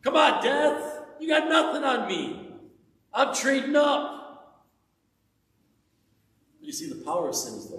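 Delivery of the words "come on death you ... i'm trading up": taunting. Pretend. Preacher taunts death on behalf of believers, high loud mocking. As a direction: goad death with a grin. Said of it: "that's really the idea".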